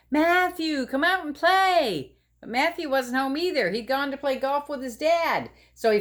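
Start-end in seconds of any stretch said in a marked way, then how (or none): 0.1-2.2 calling out. Acted. Calling to a friend.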